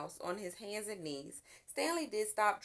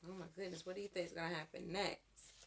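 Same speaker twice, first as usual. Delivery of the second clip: much softer, quick aside to listeners; hushed aside; 4 semitones lower, 10 dB quieter, much faster than usual. Real, not acted. Reader asking the children what happens next, hushed. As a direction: lean in and ask. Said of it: "reader aside".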